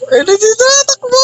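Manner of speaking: high panicked yelp; while running. Pretend. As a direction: squeal at the enemy. Panicked chase in a video game.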